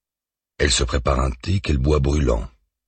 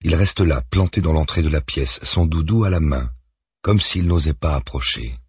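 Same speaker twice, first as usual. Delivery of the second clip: plain reading voice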